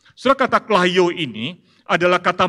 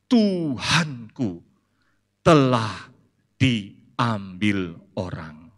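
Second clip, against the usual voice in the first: very slow, drawn-out, fading lament; a hushed, heartbroken reply; grieving Maria; 4 semitones lower, much slower than usual. Acted. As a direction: let each word drag with loss.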